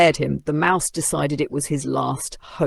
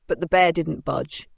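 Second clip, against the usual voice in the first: ordinary delivery, nothing marked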